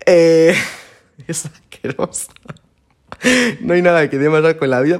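laughing through mock disgust. Real, not acted. Podcaster laughing at how gross the seed is, mock-disgusted. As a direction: giggle and pull a disgusted face.

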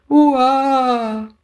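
high, loud crying wail; toddler wail. A narrator's reading. Little girl sent inside, loud crying wail. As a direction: howl like an upset toddler.

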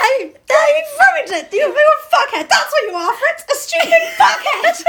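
laughing mock insult. Mock berating Fritz.

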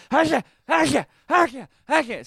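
high, rapid mock sneezing "hasha hasha"; cartoonish fake sneeze sounds; imitated sneezes. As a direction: act out a string of sneezes. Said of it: "Yeah, I was".

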